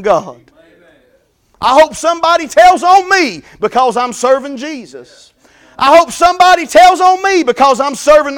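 full-force preaching. Acted. Preacher crying out to the congregation, full-force preaching. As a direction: preach it out, building fervour.

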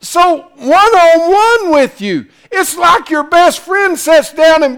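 high, loud preacher's cry, voicing God's call; fiery preaching. Preacher to his congregation about God's thirst, hollering. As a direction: lift the congregation with a ringing holler.

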